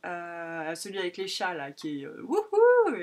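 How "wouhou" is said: a sudden high, loud whoop of delight; squealed wouhou; excited cheer. Real, not acted. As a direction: whoop with joy at the colours. Cheering a colourful fabric.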